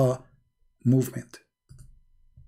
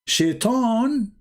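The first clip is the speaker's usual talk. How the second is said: ominous; 9 semitones higher than usual. Acted. Dramatic naming of the devil.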